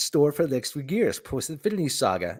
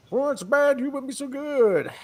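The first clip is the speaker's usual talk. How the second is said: mock high quote; 12 semitones higher, slower than usual. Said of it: "quoted line".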